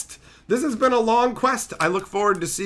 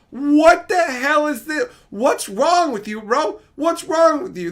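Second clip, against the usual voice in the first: exasperated; 7 semitones higher, slower than usual. Real, not acted. Yelling at the rigged wheel.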